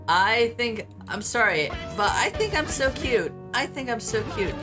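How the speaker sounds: ordinary talk, nothing marked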